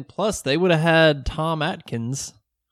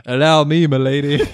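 mock chivalrous gentleman; 6 dB louder than usual. Mock gallant offer to girlfriend.

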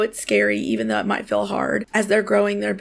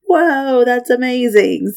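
amazed; 3 semitones higher, 7 dB louder, slower than usual. Retold or imitated. Retold own amazed reaction.

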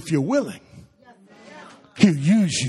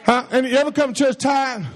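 ordinary talk

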